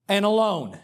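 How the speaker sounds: normal talking voice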